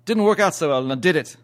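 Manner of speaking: demonstrated British phrasing; British accent. Shown as a demonstration. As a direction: tack the question on like a Brit. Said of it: "you know how the British accent".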